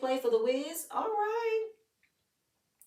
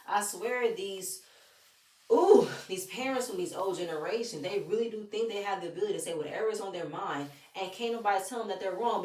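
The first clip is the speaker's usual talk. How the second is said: ordinary talk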